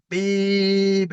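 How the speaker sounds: high, held-out imitation of a horn; long horn beep. Driver finally pumping the horn for the examiner, long imitated beep. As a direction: hold the beep on like a leaned-on horn. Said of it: "That's better. Keep it on".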